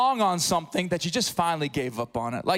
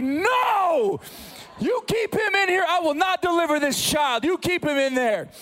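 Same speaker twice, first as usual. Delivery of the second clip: panicked; 7 semitones higher than usual. Retold or imitated. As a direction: panic at the thought of delivering. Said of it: "And I was like".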